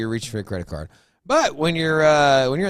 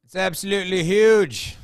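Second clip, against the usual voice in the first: a terrible, hammed-up Australian accent, higher and louder; bad Australian accent; 9 semitones higher, 6 dB louder than usual. Cohost answers in a terrible Australian accent. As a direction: fake an Australian accent badly. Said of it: "is that supposed to be an Australian accent?".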